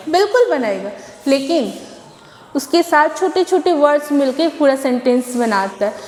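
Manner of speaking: ordinary delivery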